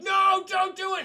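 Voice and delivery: high, loud cry to stop; imitated alarmed shout; mock desperate plea. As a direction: shout it out like a hammed-up captain. Acting the captain's plea.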